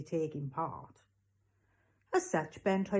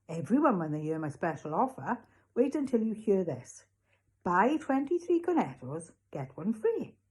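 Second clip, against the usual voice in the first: eager shopkeeper; 3 semitones higher, 3 dB louder than usual. Acted. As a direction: announce your bargain with showman's relish. Raj pitching an offer.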